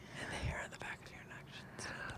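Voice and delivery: barely audible whisper; ASMR whisper. Shown as a demonstration. Showing friends how ASMR feels on the neck, faintly whispered. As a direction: whisper it very softly into the mic. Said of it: "when you talk like this".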